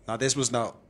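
ordinary delivery

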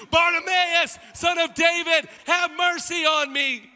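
high, loud cry, last line drawn out; screaming plea. Acted. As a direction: give it everything, then stretch the last line. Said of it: "screams from the top of his lungs".

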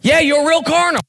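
insistent preacher building to a shout; fervent exhortation, rising to a high shout; full-force preaching. Acted. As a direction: drive each phrase home from the pulpit.

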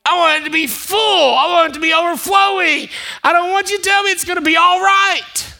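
rising pulpit shout; building preacher shout. Acted.

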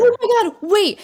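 sudden excited outburst. Real, not acted. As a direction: burst in excitedly.

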